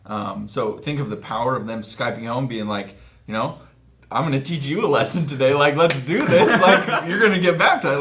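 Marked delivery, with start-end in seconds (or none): 4.1-6.6 higher, louder, hammed-up eager teacher voice; mock pumped-up missionary. Missionary on Skype to family, hammed-up eager and loud. 6.6-8.0 higher, louder, hammed-up eager teacher voice; mock pumped-up missionary. Missionary on Skype to family, hammed-up eager and loud.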